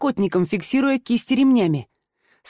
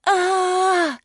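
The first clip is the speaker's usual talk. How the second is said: single high wail; high howl of pain; 9 semitones higher than usual. A narrator's reading. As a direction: cry out on one note. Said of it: "я взвыла на одной ноте".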